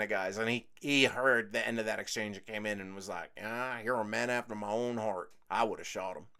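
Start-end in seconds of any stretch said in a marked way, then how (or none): 3.4-6.3 drawl. Retold or imitated. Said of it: "talks with a real strong drawl".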